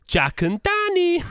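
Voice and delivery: very high, drawn-out reality TV announcer; Ian Stirling. Acted. Impersonating the show's narrator for friends, high drawn-out announcer voice. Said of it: "it's like we're watching it".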